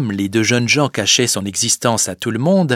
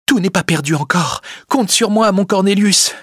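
put-on high, fervent young woman's call; urgent reassurance; 9 semitones higher, 3 dB louder than usual. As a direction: call out hope as you are led away. Rosa calls out hope while leaving. (promesse fervente, voix aiguë)

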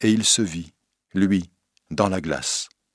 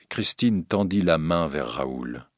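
usual speaking voice

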